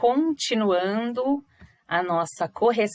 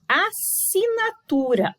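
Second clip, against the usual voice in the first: "Assinatura" devagar e agudo, AS separado de SINATURA; separação de sílabas; 9 semitones higher, slower than usual. Shown as a demonstration. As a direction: destaque a quebra entre os dois S de "Assinatura". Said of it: "Olha essa separação de sílabas especial". ("Assinatura" pausado, em tom mais alto)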